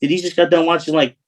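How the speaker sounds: mocking a mobster's accent; mocking disbelief. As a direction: sneer at a Sopranos wannabe. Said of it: "I'm thinking".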